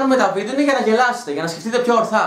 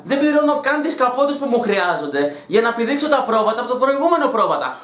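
ordinary talk, nothing marked

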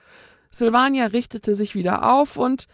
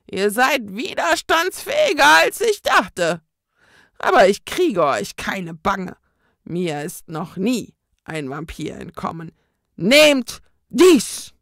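a ghost bragging and threatening; menacing ghost; 7 dB louder than usual. A narrator's reading. Ghost taunting the vampire twins before pulling the garlic chain, loud spooky threat.

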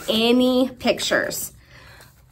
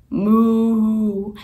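drawn-out cow moo; a long cow moo; 3 semitones lower than usual. Shown as a demonstration. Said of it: "What do cows say?".

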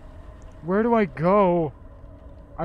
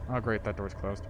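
normal talking voice

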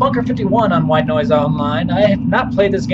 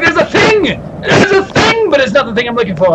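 higher, louder mock alarm; mock excitement; 8 semitones higher, 3 dB louder than usual. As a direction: squeal it as a fake discovery. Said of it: "I'm like".

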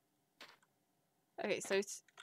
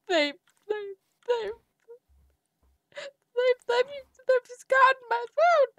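mock crying; 10 semitones higher than usual. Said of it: "even though I'm crying".

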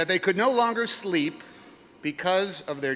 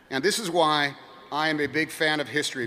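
normal talking voice

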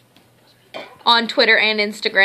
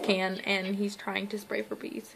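whispering; 2 semitones lower, 14 dB quieter than usual. Real, not acted. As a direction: whisper it off to the side. Whispered aside about child.

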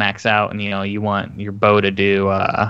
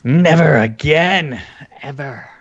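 mock solemn; 3 semitones higher, 3 dB louder, slower than usual. Mock grave refusal of fun.

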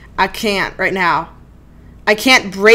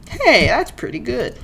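ordinary delivery

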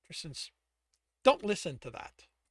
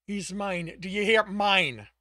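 louder, higher possessive cry; possessive outburst; 4 semitones higher, 9 dB louder, slower than usual. A narrator's reading. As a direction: claim him loudly.

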